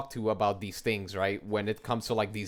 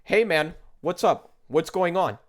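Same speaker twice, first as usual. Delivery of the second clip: higher, louder mock-casual hello; imitated friendly greeting; 5 semitones higher, 4 dB louder than usual. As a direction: greet someone openly, voice lifted. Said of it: "You could be like".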